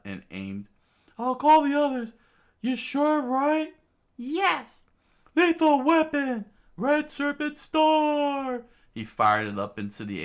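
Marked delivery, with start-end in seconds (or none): 1.2-3.7 very high, loud falsetto; double-check in a high, loud voice; high-pitched urgent checking; anxious sidekick. Acted. Usopp checks before firing. 4.2-4.8 high, loud falsetto yes; emphatic falsetto; urgent princess. Acted. Vivi confirms urgently. 5.3-8.6 very high, loud falsetto battle cry; high shout, drawn out on the last word; attack call. Acted. Usopp calls his attack.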